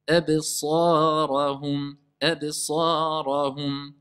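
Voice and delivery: slow, drawn-out tajwid chant; Quran recitation. Shown as a demonstration. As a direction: recite it slowly with long prolongations. Teacher recites Quran verse. (récitation lente du Coran)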